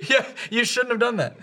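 laugh, then say it through the chuckle; high, giggly impression of an amused friend; imitating laughing. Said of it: "laughing and being like".

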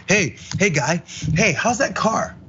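mock pushy car chatter; lightly voiced nosy stranger; crowding someone to ask about the car; in your face. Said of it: "get in their face and go".

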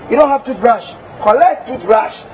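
ordinary talk, nothing marked

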